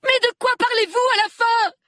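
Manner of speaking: very high, loud scream of a question. A narrator's reading. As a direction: scream it out, at wits' end. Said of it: "Dina se mit à hurler".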